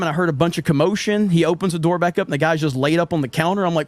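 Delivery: ordinary delivery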